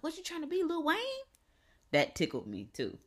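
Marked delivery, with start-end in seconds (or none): 0.0-1.0 very high mock-incredulous question; imitated grandmother. Grandmother mocking the face design, imitated high incredulity. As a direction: imitate a teasing grandmother, building louder. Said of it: "She was like".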